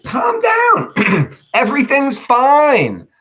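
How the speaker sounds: very high, loud, slow strained calm; comic falsely cheerful Brutus; forced calm reassurance. Acted. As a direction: insist all is fine amid chaos. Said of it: "he's telling people".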